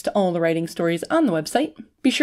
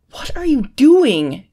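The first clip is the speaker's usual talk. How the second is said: higher, louder, slow disbelief; incredulous question; 6 semitones higher, 6 dB louder, slower than usual. A narrator's reading. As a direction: stretch it out in loud bewilderment. Bewildered man asks once more.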